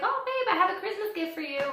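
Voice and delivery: wife teasing her husband about a Christmas gift, high and sugary; sweet teasing wife. Retold or imitated. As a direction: coo it sweetly, hiding a big surprise. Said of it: "And I was like".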